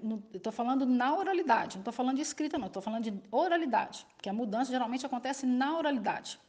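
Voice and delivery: usual speaking voice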